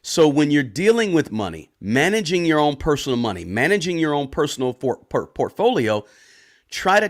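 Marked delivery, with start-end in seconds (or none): none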